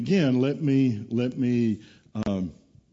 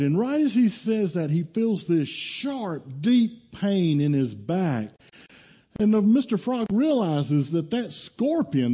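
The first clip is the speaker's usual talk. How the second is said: higher, slow, drawn-out key words; dramatic storytelling; 6 semitones higher than usual. Acted. Preacher telling of the frog being stung, dramatic and slow. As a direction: stretch out the fateful moment for suspense.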